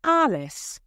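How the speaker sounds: high-pitched call of a name; calling out loudly. A narrator's reading. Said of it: "he called out loudly".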